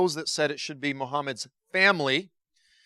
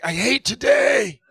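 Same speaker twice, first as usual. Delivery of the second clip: much higher, louder, slow strained yell; groggy shout; 11 semitones higher, 5 dB louder, slower than usual. Retold or imitated. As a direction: shout it like the alarm just woke you. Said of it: "You're like".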